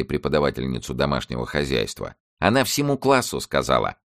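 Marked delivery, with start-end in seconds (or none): none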